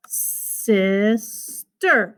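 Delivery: SISTER as a long hissed "sisss", then "ter"; overstretched syllables. Shown as a demonstration. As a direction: drag "sister" far out in two parts. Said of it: "I went a little too far".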